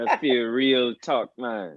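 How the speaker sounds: put-on slow, playful Jamaican accent; hamming up a Jamaican accent. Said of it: "Let me hear you".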